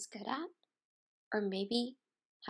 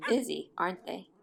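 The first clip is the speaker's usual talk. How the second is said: usual speaking voice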